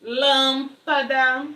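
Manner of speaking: Lâmpada stretched out slowly, syllable by syllable; slow syllable demo. Shown as a demonstration. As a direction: spell out Lâmpada slowly for the class. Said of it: "Proparoxítono". (Lâmpada bem devagar, sílaba por sílaba)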